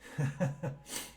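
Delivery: short voiceless chuckle; wordless airy giggle; breathy laugh. Real, not acted. Laughs after saying they're upset.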